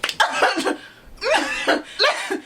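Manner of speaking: high, long burst of laughter; helpless laughter. Real, not acted. Storyteller losing it over the customer's answer, helpless laughter. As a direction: crack up, real high-pitched laughing fit. Cracking up at customer's retold reply.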